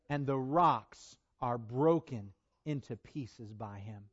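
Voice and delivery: slow, heavy, hushed question; slow and grave. Acted. Preacher asking the church in awe, slow and grave. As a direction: let each word sink in slowly. Slow solemn after reading.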